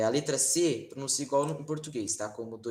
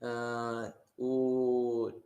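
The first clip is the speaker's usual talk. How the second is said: usual speaking voice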